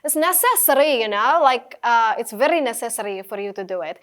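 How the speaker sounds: slow, heavy Russian accent. Shown as a demonstration. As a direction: attempt a Russian accent, drawn out. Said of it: "आप इसे Russian में बोलते हैं".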